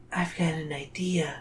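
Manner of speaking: hushed, scheming whisper; mischievous whisper. Acted. Zoe letting her friend in on a plan, mischievous whisper.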